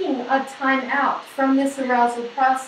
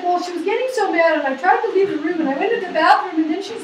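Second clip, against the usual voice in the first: higher, louder agitated retelling voice; voicing a man who withdrew; 7 semitones higher, 5 dB louder, faster than usual. Husband explaining to therapist, agitated and defensive. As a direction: rush it out like a cornered husband. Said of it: "It's like".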